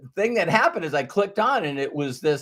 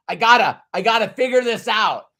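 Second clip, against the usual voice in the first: louder, higher, strained imitation; mock frustrated searcher; 5 semitones higher, 5 dB louder, faster than usual. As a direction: act out someone desperate to solve it.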